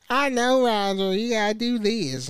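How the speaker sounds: high-pitched mocking coworker voice; whiny mocking impression. Imitating a coworker he looks down on, mocking. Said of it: "he talked like a bitch".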